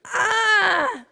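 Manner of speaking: high, loud cry of release; imitated laughing outburst. Mother recalling laughing with daughter in paint, high whooping outburst. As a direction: re-enact helpless laughter with a shouted "ah". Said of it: "in the most wonderful laughter of our life".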